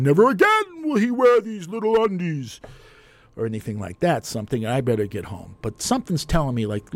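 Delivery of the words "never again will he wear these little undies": high, mocking prissy voice; a neighbour mocks his little undies. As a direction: make fun of him in a high, sing-song voice. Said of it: "people picking up my undies and saying".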